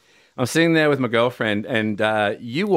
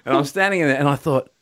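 ordinary talk

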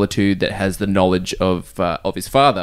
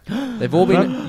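excited gasp; 9 semitones higher, much slower than usual. Real, not acted. Gasping excitement.

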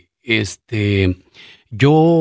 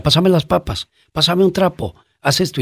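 boss giving orders; 6 semitones higher than usual. Retold or imitated. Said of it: "me decía".

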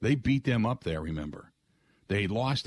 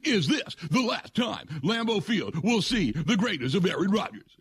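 high, dramatic mock-solemn TV question; Mike Greenberg; 9 semitones higher than usual. Host mocks an anchor asking about Rodgers' last game, high and dramatic. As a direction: ham up an overwrought sports anchor. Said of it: "in that puke and choke voice".

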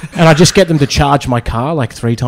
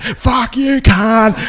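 much higher mock snarl; hammed-up drunk heckler comeback; imitating heckler; 9 semitones higher, much slower than usual. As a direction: spit it back like a drunk squaring up. Heckler squares up.

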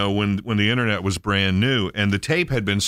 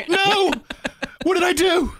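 put-on scared child voice. Kid scared by shouting man.

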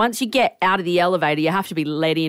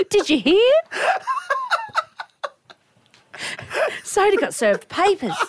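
higher, laughing gossip headline; mock gossip. Host teasing Loz as station gossip, laughing mock scandal. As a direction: spread it like juicy office gossip.

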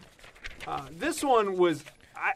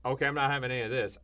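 quick refusal; 3 semitones lower, much faster than usual. Retold or imitated. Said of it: "you go".

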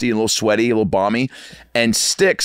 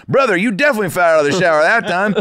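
higher-pitched Midwest military buddy; Midwest accent; 10 semitones higher, 3 dB louder, faster than usual. Retold or imitated. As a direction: imitate the thick Midwest roommate. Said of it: "real thick, uh, Midwest accent".

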